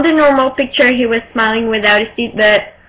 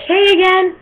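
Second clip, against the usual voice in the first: a bright, much higher "hey again"; excited greeting; 8 semitones higher than usual. Retold or imitated. Fan greeting Thomas a second time, high and giddy. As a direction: pop up high with giddy delight.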